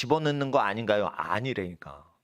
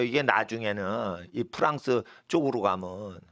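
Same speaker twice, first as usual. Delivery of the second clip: normal talking voice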